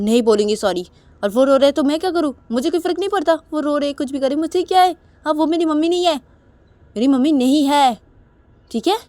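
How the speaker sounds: loud, high-pitched heated refusal; angry defiance. Acted. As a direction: throw it back loudly, refusing to care. निशा गुस्से में इनकार करती है. (गुस्से भरी ऊँची आवाज़ में इनकार)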